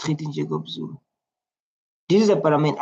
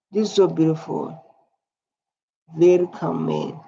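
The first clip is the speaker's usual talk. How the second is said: ordinary delivery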